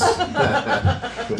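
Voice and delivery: a real laugh breaking in; short high laugh; amused laugh. Real, not acted. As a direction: laugh before going on. Laughs mid-sentence.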